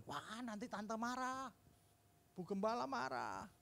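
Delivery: put-on a hesitant little kid afraid of getting caught; nervous kid.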